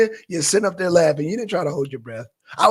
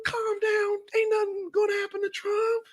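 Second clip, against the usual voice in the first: normal talking voice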